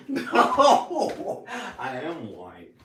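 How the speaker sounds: high burst of laughter on "No"; laughing denial. Real, not acted. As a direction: laugh it off, then say it. Laughing denial to someone off camera.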